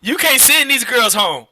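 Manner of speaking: high, loud laughing protest; amused high exclamation. Real, not acted. Joking banter, laughing.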